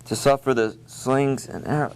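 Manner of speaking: imitating a student struggling through memorized Shakespeare; halting recitation. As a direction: recite haltingly, groping for each next line. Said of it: "they take their time, and they get it".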